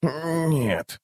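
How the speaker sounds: put-on soft, high old man's grumble; grumbling old man. A narrator's reading. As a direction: grumble it quietly like an old servant. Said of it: "проворчал Роальд".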